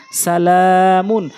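সালামুন slowly, লা held long; lam drawn out. Shown as a demonstration. Teacher applying madd in a word, lam drawn out. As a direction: stretch only the লা in সালামুন. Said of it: "শুধু এখানে লাম টান হবে".

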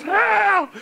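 high, panicked yelp for help; mock scream. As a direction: scream in comic desperation. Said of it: "and I'm screaming".

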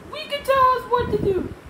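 high, loud, quick; George defiant. Acted. George grumbling at being bossed by himself, high and quick.

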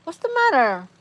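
high sing-song question to a dog; pet talk. Real, not acted. Owner checks on whining dog, pet talk. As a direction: start high and slide down, as to a pet.

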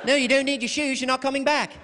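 high, loud, cheerfully sinister nanny; sing-song threat, speeding up; menacing nanny. Retold or imitated. Super nanny taking Thomas to the cliff.

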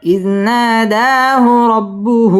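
slow, drawn-out tajweed chant; measured Quranic recitation. Shown as a demonstration. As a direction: recite slowly, stretching the long vowels. Teacher recites the verse.

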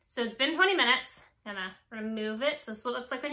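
speaking through face mask; talking with sheet mask on. Real, not acted. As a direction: lips framed by wet mask. Seen 1: Wet sheet mask covers her face while talking; peeled off near end.

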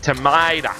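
"tomato" as tuh-MY-da, broad vowel; corrected pronunciation. Shown as a demonstration. Correcting himself to the panda, "tomato" said tuh-MY-da. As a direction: flatten the t of "tomato" to a d, ending in uh. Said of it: "I should have said".

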